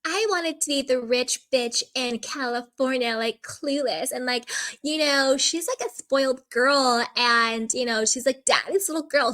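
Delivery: put-on rich-girl voice, lightly mocking; Jessel. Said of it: "Like, Jessel being like".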